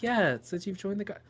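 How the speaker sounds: sweet motherly voice. Acted. Mother's voice repeats quote.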